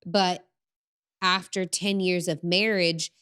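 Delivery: usual speaking voice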